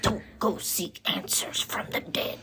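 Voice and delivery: whispered ominous warning from grandma; grandma's warning whisper. Retold or imitated. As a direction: hush it like a spooky elder. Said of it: "And she's like".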